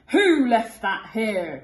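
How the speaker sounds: loud, slow, angry pirate captain; growling captain. Acted. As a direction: growl it out, boot soaked. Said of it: "growled the captain".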